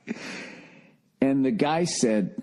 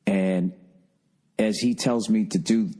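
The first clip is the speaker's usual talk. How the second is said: usual speaking voice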